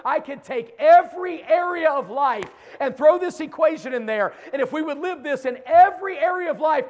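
high, loud shouting sermon; full-force preaching. Acted. Preacher shouting to young people about God's promise, climbing high and loud.

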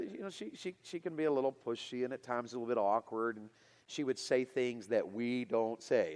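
ordinary talk

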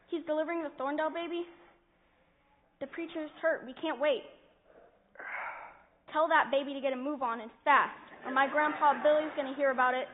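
loud and insistent, demanding; loud insisting. Acted. Boy insists the doctor come at once, loud and demanding. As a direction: bark orders down the line.

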